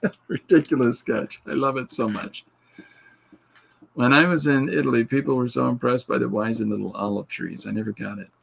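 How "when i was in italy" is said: ordinary talk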